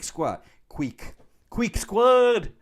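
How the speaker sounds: high, loud drawn-out call; high-pitched mock battle call; playful catchphrase shout. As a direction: holler it like a squad rallying cry. Calling out channel catchphrase.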